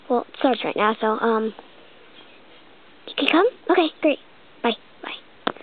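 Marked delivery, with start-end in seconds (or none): none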